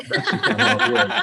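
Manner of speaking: high, loud squawking cartoon duck voice; Donald Duck voice. Shown as a demonstration. Asked about Donald Duck voice.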